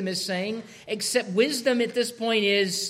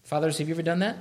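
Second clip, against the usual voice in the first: ordinary delivery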